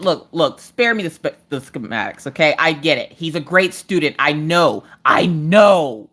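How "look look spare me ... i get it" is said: usual speaking voice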